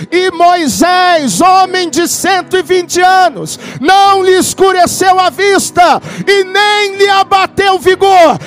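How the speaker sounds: loud, high proclamation; full-force preaching. Acted. Pastor preaching healing to the church, loud and high. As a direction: thunder the promise over the congregation.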